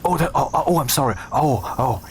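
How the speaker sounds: high, fumbling "oh, oh" apology; flustered mock apology. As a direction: play someone caught out and embarrassed. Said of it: "and then they'd be like".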